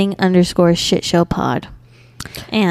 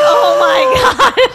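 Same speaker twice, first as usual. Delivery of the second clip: much higher, louder, slow drawn-out gasp of horror; shocked shriek; 4 dB louder, much slower than usual. Retold or imitated. Retelling remembering a friend's birthday too late, a slow shocked shriek. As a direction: stretch it out in a shocked squeal.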